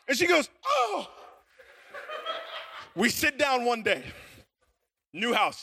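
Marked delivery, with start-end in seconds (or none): 0.7-1.1 very high mock shriek; imitated startled scream. Wife scared from behind by husband, mock shriek. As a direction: mimic a frightened wife's squeal.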